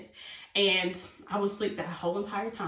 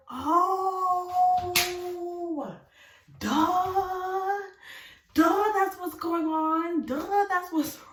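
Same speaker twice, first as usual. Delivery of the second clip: high, loud, long-drawn duh; singsong mock revelation, stretched right out; dawning realization; 11 semitones higher, 5 dB louder, much slower than usual. Realizing it was the edible, dawning realization.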